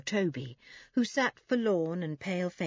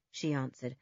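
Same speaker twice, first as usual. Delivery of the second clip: ordinary delivery, nothing marked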